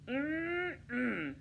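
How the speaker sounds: a sly, pleased little hum; smirking wolf. Acted. Wolf pleased by Little Red's answer, smirking. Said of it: "the wolf smirked".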